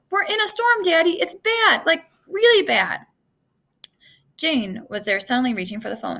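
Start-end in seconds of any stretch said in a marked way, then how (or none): 0.1-3.1 high, loud frightened plea; girl telling her dad about the storm, frightened; scared. Acted. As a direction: let the fear spill into the words. Scared girl phoning father.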